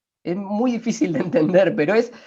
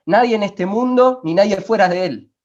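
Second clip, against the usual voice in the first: emphatic preaching; 5 semitones higher, 6 dB louder than usual. Real, not acted. Pastor predica con fuerza.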